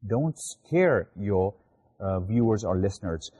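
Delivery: slow it right down so the rule sinks in; slow restated rule. Shown as a demonstration. Teacher repeats the rule to students, slowly. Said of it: "do not scare the viewers or listeners".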